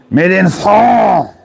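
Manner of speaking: high, slow hammed-up French exclaim; mock French. Reading the fork label to the camera in a mock French voice. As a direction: drawl it like a cartoon Frenchman.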